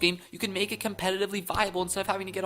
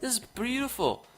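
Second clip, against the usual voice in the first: freaking out; 7 semitones higher, 5 dB louder than usual. Real, not acted. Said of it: "me freaking out that much".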